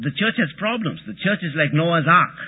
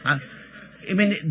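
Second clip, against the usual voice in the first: normal talking voice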